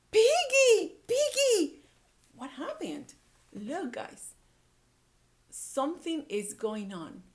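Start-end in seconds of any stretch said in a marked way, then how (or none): 0.1-1.8 loud high call for Piggy; shouting. Acted. Elephant shouting for his friend Piggy, alarmed. Teacher voicing Gerald calling Piggy.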